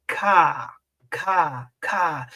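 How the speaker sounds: Ka, ka, ka, each with a forceful hard k. Shown as a demonstration. Teacher drilling the sound with force. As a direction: push out ka with force. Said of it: "listen to the difference of the sound".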